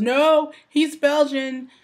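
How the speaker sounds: high, loud, slow punchline; loud teasing call. Retold or imitated. Coworker points at husband.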